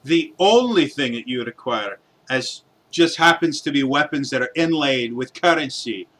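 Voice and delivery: ordinary talk, nothing marked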